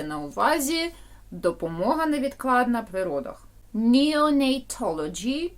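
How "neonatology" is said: normal talking voice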